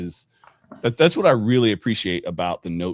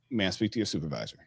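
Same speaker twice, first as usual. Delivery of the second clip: polite demand; 7 dB quieter, faster than usual. Shown as a demonstration.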